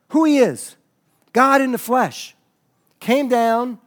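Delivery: forceful proclamation. Real, not acted. Preacher declaring Jesus as God, forceful proclamation. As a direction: proclaim each phrase with weight.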